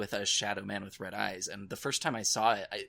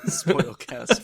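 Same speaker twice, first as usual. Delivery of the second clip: laughing; 6 semitones higher, 9 dB louder than usual. Real, not acted. Joking about spoilers, laughing.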